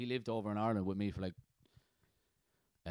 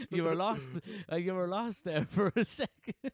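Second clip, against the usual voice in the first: high-pitched, talking through laughter; laughing; 8 semitones higher than usual. Real, not acted. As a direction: tease while cracking up. Teasing amid shared laughter.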